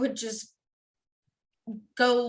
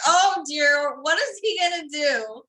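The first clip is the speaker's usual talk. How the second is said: louder, higher mock alarm; mock dread; 6 semitones higher, 10 dB louder, slower than usual. Mother acts out their dread of Satan's next move, louder. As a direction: ham up the worry for a laugh. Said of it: "we're like".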